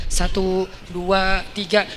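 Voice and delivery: ordinary talk, nothing marked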